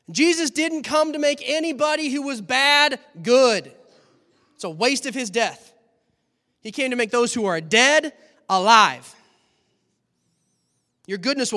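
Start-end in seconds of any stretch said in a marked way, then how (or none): none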